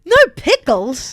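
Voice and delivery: mock outrage. Outraged diner bit.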